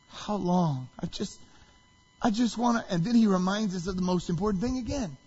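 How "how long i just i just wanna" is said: halting, broken-off plea; weeping lament. Retold or imitated. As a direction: struggle to get the words out. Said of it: "he weeps".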